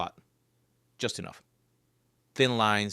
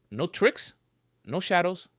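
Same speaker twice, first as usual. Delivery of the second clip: usual speaking voice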